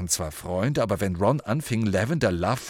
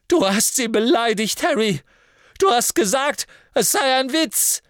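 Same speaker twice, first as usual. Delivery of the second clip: much higher, loud furious yell; angry shout; 8 dB louder than usual. Acted. Ron dangling upside down, yelling at Harry furiously. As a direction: yell the accusation. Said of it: "rief Ron".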